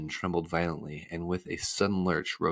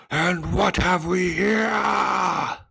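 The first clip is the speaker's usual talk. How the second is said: high, loud, drawn-out roar; roaring giant; 12 semitones higher, 7 dB louder, much slower than usual. A narrator's reading. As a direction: roar slowly at tiny captives. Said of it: "he roared".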